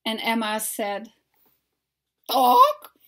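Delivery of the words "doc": a high, loud clucked "Doc". Acted. Hen squawking at the hidden eggs, high cluck.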